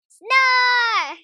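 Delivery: one sudden booming snore; loud snore. Acted. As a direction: belt out one huge snore. Loudest snore of the run.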